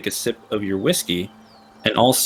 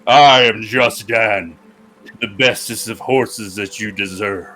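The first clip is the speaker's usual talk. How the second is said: slow, grand horse voice; proud steed announcing itself; majestic horse; slower than usual. Acted.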